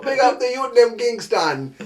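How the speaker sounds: high, loud patois, "Kingston" drawn slowly; mock patois. Host echoes the clip's shout-out in patois. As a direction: relish the clip's line in patois.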